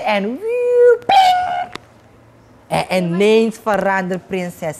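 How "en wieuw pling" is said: very high whooshing then pinging effect; magic whoosh. Acted. Spell landing on the girl, high whoosh and ping.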